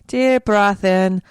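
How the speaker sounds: breathing cue. Pretend. Host settles herself after dropping the accent, slow high breathing cue. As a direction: lift and stretch it like an inhale. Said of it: "coming back to the normal".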